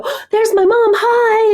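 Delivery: high, bright cheery greeting; imitating her mother. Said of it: "my mom would go".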